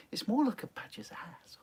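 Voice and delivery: whispered naughty aside; cheeky whisper. Real, not acted. Streamer sneaking a rude joke to viewers, cheeky whisper. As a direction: drop to a whisper for the rude bit.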